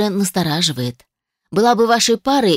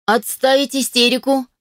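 sharp higher barked order; barked command; 6 semitones higher than usual. A narrator's reading. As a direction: snap her out of the hysterics. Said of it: "рявкнула я".

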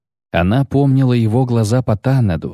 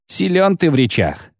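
plain reading voice

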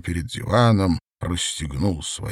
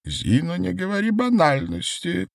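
high man's voice, not raised; high-pitched voice; 11 semitones higher than usual. A narrator's reading. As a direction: pitch it way up, mildly chiding. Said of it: "послышался приближающийся высокий голос".

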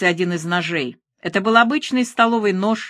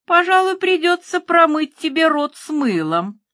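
much higher sing-song taunt; mock scolding; 10 semitones higher than usual. A narrator's reading. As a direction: chide her like a naughty child, pitched way up. Killer mockingly scolds her for swearing. (высоко, с издевательским укором)

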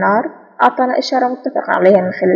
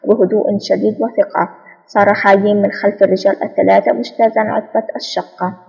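ordinary delivery

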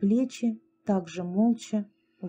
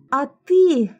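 loud, higher scornful jab; open contempt; 5 semitones higher, 8 dB louder than usual. A narrator's reading. Lera turning on Mari, contemptuous. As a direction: spit it with contempt. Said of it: "с нескрываемым презрением".

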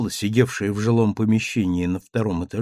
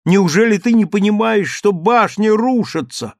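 desperate plea; 8 semitones higher, 5 dB louder than usual. A narrator's reading. Daughter pleads with mad father.

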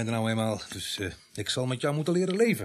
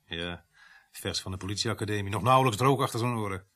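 usual speaking voice

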